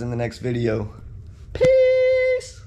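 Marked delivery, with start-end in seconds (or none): 1.5-2.6 high, loud parting shout; cheery sign-off. Real, not acted. Vlogger signs off to viewers, loud high shout. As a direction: fling out a big goodbye.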